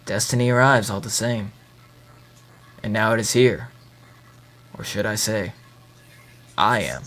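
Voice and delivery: low, quiet menacing promo voice; wrestling promo. Pretend. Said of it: "What are you, cutting a promo?".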